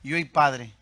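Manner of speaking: low, solemn prayer voice; congregational prayer. Real, not acted. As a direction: address the Father with measured pauses.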